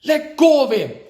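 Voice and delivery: high, loud shout; the boy shouting at the nurses carrying Grandpa. A narrator's reading. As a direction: yell it to make them stop. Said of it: "shouted the boy".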